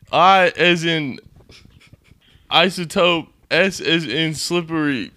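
slow spelling. Acted. Prank caller spells word slowly.

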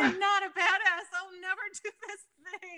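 high, whiny mock sob; mock whiny crying. Guest imitating her younger self crying to her husband. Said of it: "And I was like".

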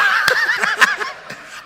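high, loud disbelieving laugh; burst of laughter. Real, not acted. Pastor laughs at the congregation's debate, high and loud.

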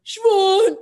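put-on high, loud drunken-style holler upward; imitated shout from the street; mock calling up from below. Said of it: "he's like".

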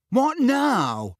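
high, exasperated impatience; impatient. A narrator's reading. Harry snaps at his uncle on being called back, impatient. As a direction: pitch it up, fed up at being held back. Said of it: "said Harry impatiently".

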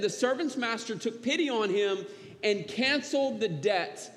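ordinary talk, nothing marked

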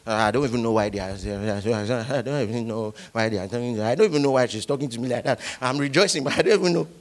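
fast, low mock grumbling; lightly voiced muttering complainer; imitating a grumbler. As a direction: rattle off a complaint under your breath. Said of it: "Have you ever seen somebody who is rejoicing?".

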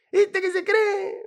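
high, slow gocho drawl; gocho accent. Retold or imitated. Gocho greeting a friend abroad, slow high gocho accent. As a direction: stretch ¿Viste que se cree? in gocho accent. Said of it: "vamos a hablar gocho".

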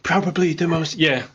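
high-pitched presidential impression; Donald Trump. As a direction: play up the superlatives as a mimic. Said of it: "You do a good Trump".

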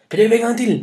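high, loud call; shouted encouragement. A narrator's reading. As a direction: call out urgently to spur someone on. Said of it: "ropte Thor".

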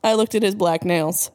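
ordinary delivery, nothing marked

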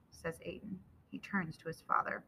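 usual speaking voice

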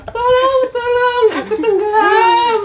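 very high camp falsetto, crying for help; hammed-up drowning drag queen. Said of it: "Diem, bencong". (suara bencong melengking minta tolong)

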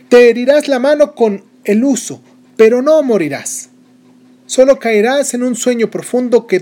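high, loud, reassuring proclamation. Acted. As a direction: call out to calm the whole hall. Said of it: "dijo en voz alta". (en voz alta, tranquilizando a todos)

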